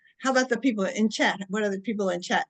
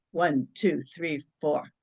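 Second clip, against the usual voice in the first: low, even count with spaces between numbers; measured counting; 7 semitones lower, much slower than usual. Shown as a demonstration. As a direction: count them calmly through the breath. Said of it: "and I'll go".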